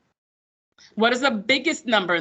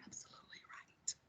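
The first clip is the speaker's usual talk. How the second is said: whispered aside; 29 dB quieter than usual. Real, not acted. Aside praising a student.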